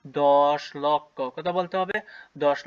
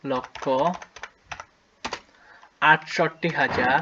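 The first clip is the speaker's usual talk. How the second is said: slow dictation; much slower than usual. Shown as a demonstration. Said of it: "আমরা লিখবো প্রথমে পঁচিশ লক্ষ".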